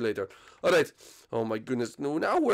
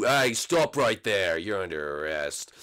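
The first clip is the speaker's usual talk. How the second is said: low, slow commanding officer; cop voice; 6 semitones lower, slower than usual. Acted. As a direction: halt him like a cop. Officer voice arresting.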